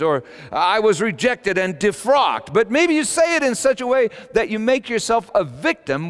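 high, loud, driving sermon delivery; impassioned preaching. Real, not acted. Preacher voicing his congregation's boasts, impassioned and forceful. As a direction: pile up the examples at full force.

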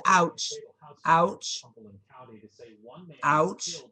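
sharp loud ouch at each bite; bitten, loud ouch; real yelps of pain. Real, not acted. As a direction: yelp as the teeth sink in. Pet bites her hand.

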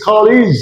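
কলিজ with long "i" in the last syllable; new "-ij" ending. Shown as a demonstration. Teacher showing the corrected way, কলিজ with "-leez". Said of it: "এখন কি বলবো?".

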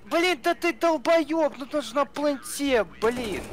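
high, exasperated yell; angry yelling. Real, not acted. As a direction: scold him for missing the plant. Yelling at teammate over plant.

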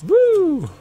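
light, high-pitched "woo"; airy rising cheer; high whoop. Real, not acted. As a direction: whoop as if taking off. Flies up to view the build.

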